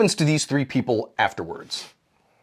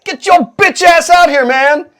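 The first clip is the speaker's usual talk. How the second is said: high, loud, slow holler; hammed-up street holler; 9 dB louder, slower than usual. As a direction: yell him out of the tomb. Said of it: "He just hollers".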